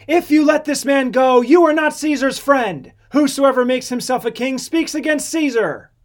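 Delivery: loud, high warning shouted from a crowd; crying out. A narrator's reading. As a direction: call it out as a public threat.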